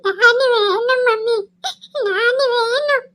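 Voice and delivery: slow tearful plea, voice breaking; crying. Acted. Child crying to mummy to keep daddy. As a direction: sob it out, begging for daddy.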